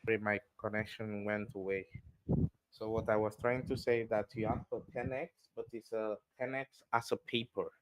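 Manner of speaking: barely audible bad mic; faint, far from the mic; very low volume. Real, not acted. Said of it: "voice went very low in volume".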